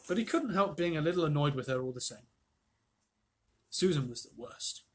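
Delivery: ordinary delivery, nothing marked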